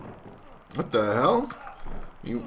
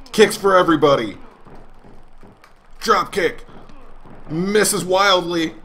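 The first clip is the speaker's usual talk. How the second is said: play-by-play; 5 semitones higher than usual. Acted. Gamer narrating moves as announcer, play-by-play. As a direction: call each move like a commentator.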